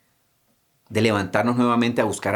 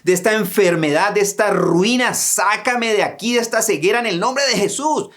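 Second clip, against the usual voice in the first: crying out to God; 7 semitones higher than usual. Acted. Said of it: "Es tiempo de gritar y decirle".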